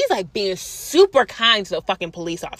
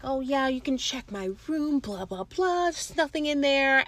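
lightly voiced fake-polite man; a hint of a sly man acting innocent; mocking the thief; 4 semitones higher, 8 dB quieter than usual. To the officer, a thief lightly mimicked as smooth and innocent.

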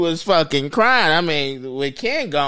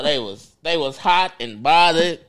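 ordinary delivery

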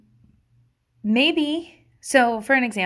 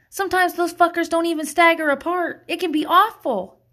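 ordinary delivery

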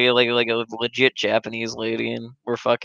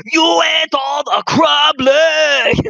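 put-on high, loud, slow accented yell; mock angry yell; 13 semitones higher, 6 dB louder, much slower than usual. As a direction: bellow at the customer. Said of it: "yelling at some fat fuck".

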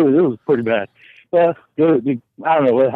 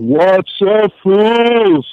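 loud greeting; 5 semitones higher, 5 dB louder, much slower than usual. Real, not acted.

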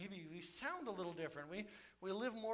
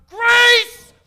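huge ringing shout of grace; booming cry of grace; 31 dB louder than usual. Acted. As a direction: shout it at the mountain with full force. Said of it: "He shouts these two words".

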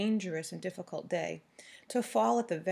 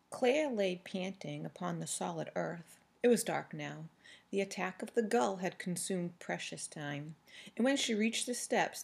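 ordinary delivery, nothing marked

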